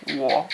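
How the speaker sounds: wo low in the voice and short; third tone wo. Shown as a demonstration. Teacher demonstrates the third tone on wo, low and short. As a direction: drop wo to the bottom of the voice, brief. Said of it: "the lowest part of your talking voice and, um, make it short".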